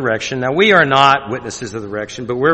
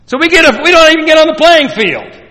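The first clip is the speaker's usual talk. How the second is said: high, loud preaching peak; fiery preaching; 14 semitones higher, 8 dB louder than usual. Acted. Preacher rebuking American churches, fiery and loud. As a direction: drive it home from the pulpit.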